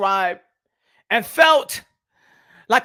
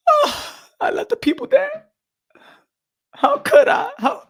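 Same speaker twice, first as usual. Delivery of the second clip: high wailing fake despair; hammed-up anguished wail, much higher; mock anguish; 14 semitones higher than usual. To his fans, wailing in mock despair.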